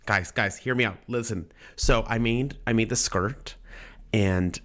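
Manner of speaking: ordinary talk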